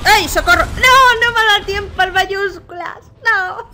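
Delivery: loud high cries of no; high panicked yells. Real, not acted. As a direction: yell out as you die too fast. Killed before reacting, frustrated.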